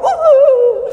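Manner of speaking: high, loud whoop; carried away in a rush of excitement; ecstatic whoop. Retold or imitated. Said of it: "and you're like".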